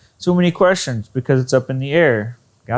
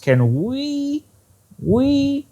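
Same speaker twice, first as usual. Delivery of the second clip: "we" swooping up very high; emphasized WE; 12 semitones higher, much slower than usual. Shown as a demonstration. Teacher isolates the emphasized word, "we" rising very high. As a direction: lift "we" sharply into a high, stretched question. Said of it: "is really emphasized".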